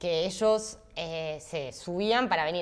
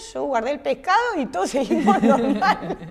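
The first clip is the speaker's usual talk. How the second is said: higher and louder, breaking into laughter; real amused laugh; 8 semitones higher, 7 dB louder than usual. Real, not acted. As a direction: laugh at how normal it all was. Laughing at how nothing happened.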